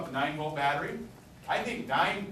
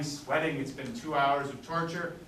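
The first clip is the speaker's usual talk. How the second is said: ordinary delivery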